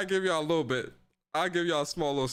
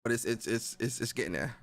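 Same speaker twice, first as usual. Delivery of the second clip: usual speaking voice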